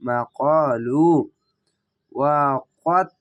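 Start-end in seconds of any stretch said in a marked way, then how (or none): 0.1-1.1 মা কলু very slow and drawn out; slow recitation. Shown as a demonstration. Teacher blends syllables into মা কলু, slow recitation. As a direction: draw out মা কলু slowly. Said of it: "লাম ওয়া পেশ লু".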